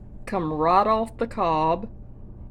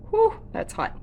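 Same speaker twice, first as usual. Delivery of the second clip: startled whew; 14 semitones higher, 3 dB louder than usual. Real, not acted. Reacting to a very hot pan.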